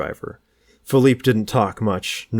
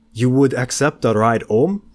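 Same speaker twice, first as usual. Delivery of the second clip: lightly voiced French accent, a bit higher and louder; heavy French accent; 5 semitones higher, 5 dB louder than usual. A narrator's reading. Stranger offers George a ride, light French accent. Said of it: "a voice with a heavy French accent".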